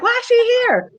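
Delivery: put-on high, indignant girlfriend voice; mock shrill demand. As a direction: play the other woman, outraged. Other woman demanding, voiced high.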